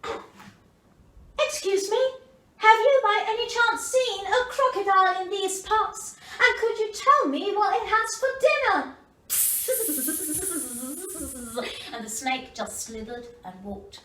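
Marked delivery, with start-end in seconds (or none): 1.4-8.8 higher, small polite little voice; a curious young elephant asking politely; baby elephant voice. A narrator's reading. Baby elephant asking the snake, higher polite voice. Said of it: "the baby elephant said".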